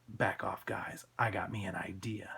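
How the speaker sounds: low, soft thug voice; menacing thug. Acted. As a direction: warn them off low and sinister.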